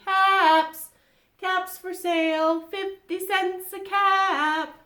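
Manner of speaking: loud, high sing-out sales cry; street vendor calling. A narrator's reading. Peddler to townsfolk, selling caps, calling out. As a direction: hold the pitch and stretch the last word. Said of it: "he walked back to town calling".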